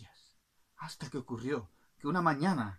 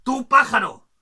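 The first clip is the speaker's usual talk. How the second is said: loud, raised royal outburst; indignant king; 10 semitones higher, 15 dB louder than usual. Acted. King scolds a passing bird, loud and indignant. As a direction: challenge the bird loudly, affronted. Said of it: "Y el rey, viéndolo, dijo".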